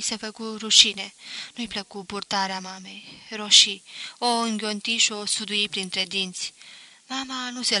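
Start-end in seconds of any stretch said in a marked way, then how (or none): none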